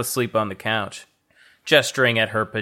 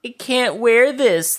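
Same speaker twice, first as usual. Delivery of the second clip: much higher whine, stress on wear; whiny high Thea voice; 13 semitones higher than usual. Acted. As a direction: whine it like a sulky child. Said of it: "Thea whined".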